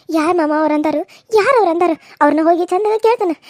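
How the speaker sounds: loud, rising call to go now; loud urging. Acted. Niece to uncle, loudly urging him to confront the gossips. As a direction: rouse him to march out.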